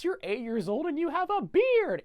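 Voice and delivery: put-on high, loud taunting kid voice; mocking bully. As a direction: sneer it up high like a schoolyard bully. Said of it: "he goes".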